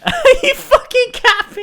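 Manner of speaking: mock outraged squeal. Friend parroting new slang back, mock squeal.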